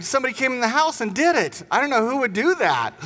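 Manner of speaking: a hint of a small boy feigning innocence; innocent child. Son invents excuses to dad.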